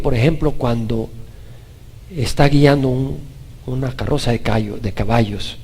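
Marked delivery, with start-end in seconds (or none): none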